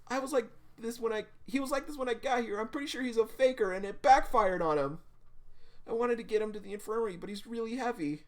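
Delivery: high, frantic tearful explanation; freaking out. Acted. Evie explaining the fallen guy to Remy, freaking out. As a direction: babble excuses in a high panicked voice. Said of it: "Evie's just freaking out, crying".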